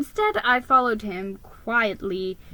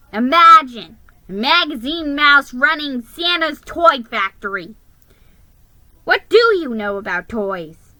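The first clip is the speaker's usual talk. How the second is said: louder scornful complaint; scornful grumbling elf; complaining; 3 semitones higher, 8 dB louder than usual. Acted. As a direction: sneer at the magazine mouse. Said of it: "he complained".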